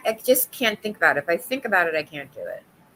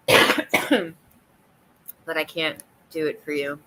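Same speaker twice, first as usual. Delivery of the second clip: ordinary talk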